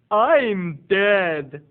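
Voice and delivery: loud, stretched, morbid announcement; hammed-up slow pronouncement; imitated eccentric aunt. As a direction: declare it gravely in the odd aunt voice. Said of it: "she talks like this".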